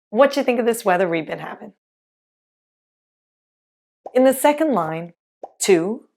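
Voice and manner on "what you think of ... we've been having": what do you squeezed to whatcha, havin' clipped; quick, run-together casual question; rapid whatcha-style phrasing; fast reduced speech. Shown as a demonstration.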